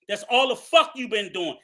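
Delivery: normal talking voice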